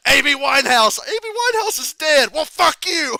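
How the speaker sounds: very high, loud mock shouting; imitating yell. Quoting Cena's rant at the guy, loud mock yell. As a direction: yell the names back in his voice. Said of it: "He just keeps going".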